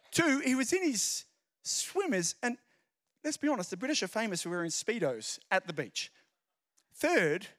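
normal talking voice

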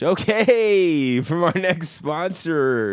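high, loud laughing sponsor intro; laughing announcer. Acted. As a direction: announce the sponsor while cracking up. Mock ad break mid-laugh.

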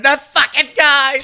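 high, drawn-out mock cry; quoting a shocked friend's text; mock outrage. Coworker's late-night message.

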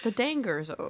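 "Danger" said to rhyme with "anger"; deliberate mispronunciation. Shown as a demonstration. Player renaming the Top Gun song, "Danger" as dang-ger. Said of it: "as I like to call it".

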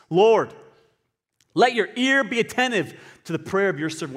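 impassioned scripture plea; fervent prayer. Acted. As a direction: call out to God in earnest. Reads out Nehemiah's prayer fervently.

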